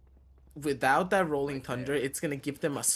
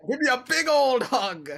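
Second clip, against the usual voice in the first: high, loud goofy plea; clingy hug voice; 10 semitones higher, 5 dB louder than usual. Retold or imitated. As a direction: beg for a hug, hammed up. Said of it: "and he's like".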